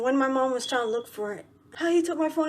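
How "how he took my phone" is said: higher-pitched complaining mother; imitated scolding mom. Mom complaining to daughters about her phone, scolding. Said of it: "mom was chewing me and my sister out".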